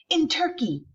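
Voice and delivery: high eager shout. Acted. Kid shouts his answer across the classroom, high and eager. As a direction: blurt it out like a know-it-all kid. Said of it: "he shouts out".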